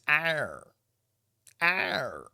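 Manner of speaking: high growly pirate "Arr". Retold or imitated. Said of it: "they be sound like pirates".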